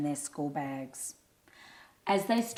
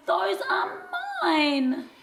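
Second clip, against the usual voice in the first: high loud slow cry; shouting back; 13 semitones higher, 4 dB louder, slower than usual. A narrator's reading. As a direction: yell it right back, just as hard. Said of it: "Tobias shouted".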